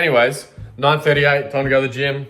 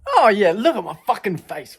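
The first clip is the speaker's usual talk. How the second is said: excited; 9 semitones higher, 4 dB louder than usual. Real, not acted. Mock excitement at own face.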